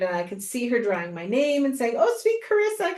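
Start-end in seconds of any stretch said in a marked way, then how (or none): 2.0-3.0 much higher sing-song imitation of the host; sweet host voice. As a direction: coo it like the host greeting a fan. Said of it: "that's what she called me".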